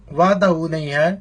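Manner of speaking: ordinary delivery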